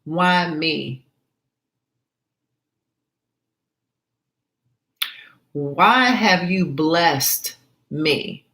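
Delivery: slow, wondering why me; grateful wonder. Shown as a demonstration. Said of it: "I ask the question".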